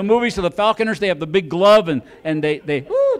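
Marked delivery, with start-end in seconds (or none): 2.8-3.2 high-pitched whistle "woo"; imitated whistle. Falconer calling the hawk, high whistle imitation. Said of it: "they blow the whistle".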